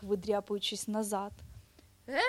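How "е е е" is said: long wavering bird screech; bird-like squeal. A narrator's reading. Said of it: "по пташиному заверещав їжачок".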